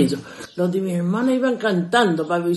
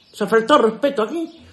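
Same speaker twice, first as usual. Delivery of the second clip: mother scolding; 4 semitones higher, 6 dB louder than usual. Retold or imitated. Mother catches daughter kissing.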